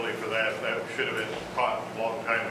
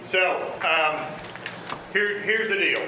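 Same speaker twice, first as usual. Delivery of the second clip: raised, higher projecting teacher voice; 6 semitones higher, slower than usual. Real, not acted. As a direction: project to the back of the room. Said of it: "I'll get my teacher voice here".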